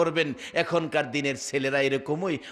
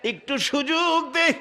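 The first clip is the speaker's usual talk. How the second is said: acted chanted entreaty, easing downward; pleading call; 7 semitones higher, 4 dB louder than usual. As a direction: call out to the judge, begging for a chance. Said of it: "যুবক ডাকতেছে".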